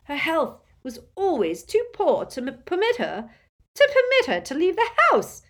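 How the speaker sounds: high, loud, stammering plea; desperate. A narrator's reading. Doge excusing Ariana's absence, desperate and stammering. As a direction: stumble over the words, climbing in desperation. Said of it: "said Doge desperately".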